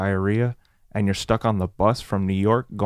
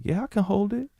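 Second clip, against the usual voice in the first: high, tight, softer mock reassurance; strained reassurance; 10 semitones higher, 4 dB quieter than usual. Desperate passenger telling himself he's fine, strained high voice. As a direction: squeeze it out while holding on.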